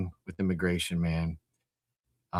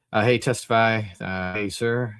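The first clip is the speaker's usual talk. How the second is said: ordinary talk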